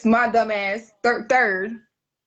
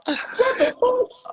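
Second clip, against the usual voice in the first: high incredulous squeal; much slower than usual. Retold or imitated. Disbelief after retelling.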